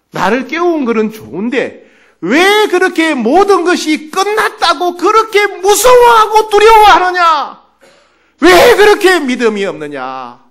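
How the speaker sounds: high, loud, drawn-out scolding; stern rebuke. Acted. Jesus scolds the frightened disciples, sternly at full force. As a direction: thunder each reproach slowly. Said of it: "예수님이 책망하셨어요".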